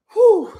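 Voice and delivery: high, loud breathless whew; loud laughing whew. Real, not acted. Streamer exhaling loudly after laughing hard. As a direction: blow it out after a fit of laughter.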